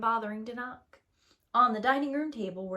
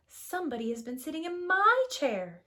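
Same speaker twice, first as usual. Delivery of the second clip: Mama Bear; 7 semitones higher than usual. Acted. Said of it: "said Mama Bear".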